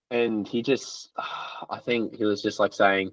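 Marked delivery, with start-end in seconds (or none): none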